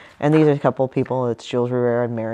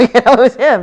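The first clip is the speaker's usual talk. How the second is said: loud, high, laughing punchline; 10 semitones higher, 12 dB louder than usual. Real, not acted. Telling viewers the creep was her partner, laughing. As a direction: burst out laughing as you say it.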